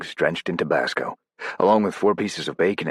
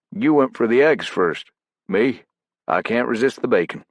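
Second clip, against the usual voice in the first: voiced operator, pitched up; character voice, higher and louder; 9 semitones higher, 5 dB louder than usual. Narrator voicing Morvant's dialogue.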